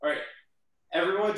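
ordinary delivery

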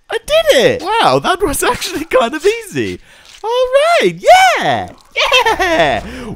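high, gleeful disbelief; giddy victory squeal; excited triumph. Real, not acted. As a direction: celebrate the win. Victory over tiger.

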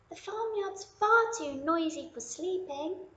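a small bunny who can't sleep; sleepless little bunny. Acted. Lily voiced high.

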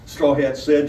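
plain reading voice

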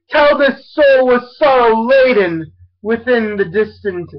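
ordinary delivery, nothing marked

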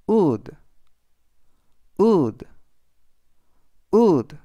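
"wood" with rounded lips and a short oo; model pronunciation. Shown as a demonstration. Teacher says "wood" for students, firm and rising in volume. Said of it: "라고 발음하시면 되겠습니다".

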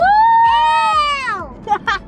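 high whooping laugh; loud laughing shout. Real, not acted. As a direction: burst out in a shrieking laugh. Big laugh after rhyme.

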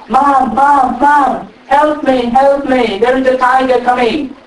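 high, loud panicked calls for mom; frightened cry for help. Acted. Young buffalo screaming to his mother about the tiger.

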